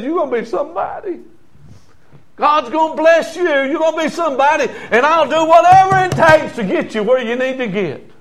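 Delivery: put-on high, fervent pledge to a child; preacher voicing a dad's rousing blessing; encouraging father. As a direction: build up a child with full conviction.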